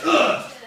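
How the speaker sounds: loud, high dying grunt; mock death grunt. Soldier killed by Macbeth, hammed-up death grunt. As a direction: grunt as if struck down.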